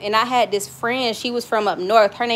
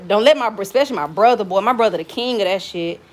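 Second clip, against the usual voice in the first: ordinary talk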